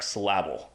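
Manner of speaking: syllable said as "sluh-LAB-ul"; syllable with the emphasis wrongly on LAB; misplaced stress. Shown as a demonstration. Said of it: "emphasis is on the wrong syllable".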